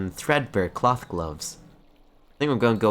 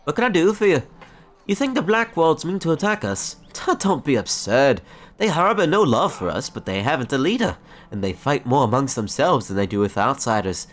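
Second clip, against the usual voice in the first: high, lively scoffing character voice; put-on game character voice, pitched up; indignant townsperson; 7 semitones higher, 4 dB louder than usual. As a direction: dismiss the threat with lofty scorn.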